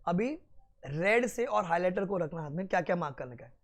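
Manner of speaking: ordinary talk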